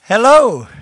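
loud hello with a falling tune; loud greeting. Real, not acted. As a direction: greet the crowd loudly. Greeting the audience from stage.

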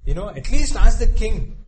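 loud, high pleading request. Retold or imitated. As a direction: beg him loudly to ask the king. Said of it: "She's also saying".

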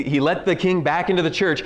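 usual speaking voice

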